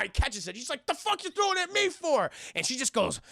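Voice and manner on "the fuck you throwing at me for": high-pitched mock outrage; acted indignant squawk; indignant protest. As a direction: demand an explanation, voice pitched up. Acted indignant reply to thrown pipe.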